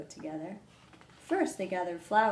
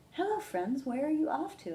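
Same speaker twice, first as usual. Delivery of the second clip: higher, friendly snake; a cheerful higher snake greeting; Snake's voice; 8 semitones higher than usual. Acted. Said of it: "asked Snake".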